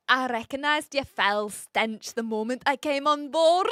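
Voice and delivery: hammed-up Scottish movie quote; mock Scots accent, ready to laugh. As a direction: declaim it as an amateur Scot. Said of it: "my best attempt at a Scottish accent".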